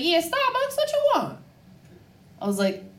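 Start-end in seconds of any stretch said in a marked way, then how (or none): 0.0-1.4 high-pitched curt service greeting; imitated drive-thru worker. Baltimore drive-thru worker to customer, imitated high voice. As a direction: answer high, like an impatient server.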